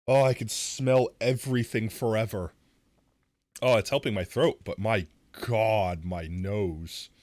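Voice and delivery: real loud overwhelmed reaction; loud menthol exclaiming. Real, not acted. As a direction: blown away by the sting. Menthol still burning in nose.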